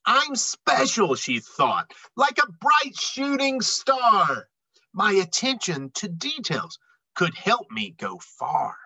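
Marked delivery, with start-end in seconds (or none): none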